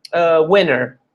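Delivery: normal talking voice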